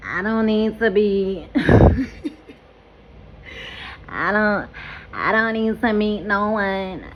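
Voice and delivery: slow, dismissive put-on voice of the girlfriend; imitating the girlfriend. Mocking her child's father's girlfriend in a slow put-on voice. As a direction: drag it out like she can't be bothered. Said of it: "that's really how she sounded".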